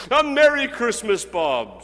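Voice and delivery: loud, high, joyful cry; hearty greeting. A narrator's reading. Scrooge clapping Bob on the back, loud and joyous. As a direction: beam it at him with full heart. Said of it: "with an earnestness that could not be mistaken".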